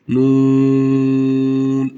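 Nun held four elif, chanted; long nun. Shown as a demonstration. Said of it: "Ve uzatarak okuyoruz". (Nun dört elif uzatarak)

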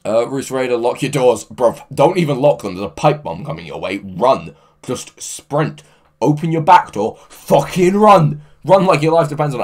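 yelling a joking warning at a viewer; mock urgent warning. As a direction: ham up the panic, push harder each line. Mock warning to chat user.

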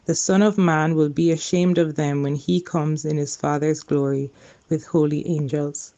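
normal talking voice

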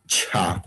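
"cha" with a ch onset; demonstrated cha sound. Shown as a demonstration. Teacher models a sound for learners, "cha" with ch. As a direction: say "cha", tongue stopping before the hiss. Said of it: "vamos a decir".

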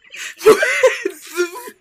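high burst of cackling laughter; loud laughing fit. Real, not acted. Teen laughing at her [name] prank, loud cackle. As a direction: laugh hard at your own joke.

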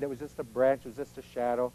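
put-on calm the kid down gently; reassuring parent. Parent soothing a scared child at night, softly.

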